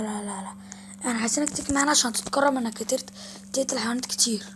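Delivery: ordinary talk, nothing marked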